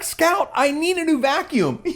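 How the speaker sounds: high, bright-eyed waitress leaning in. Retold or imitated. Dad retelling how the Disney waitress answered his daughter, in her high voice. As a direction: voice the eager waitress well above your own.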